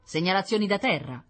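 suspicious. A narrator's reading. Onlooker commenting on signals from the ground, mildly suspicious. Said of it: "commentò una voce sospettosa".

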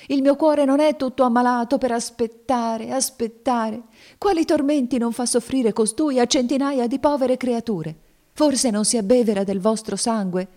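high, loud, vehement protest; impassioned exclamation. A narrator's reading. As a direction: pour out the grievance at high pitch. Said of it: "esclamò Cassie".

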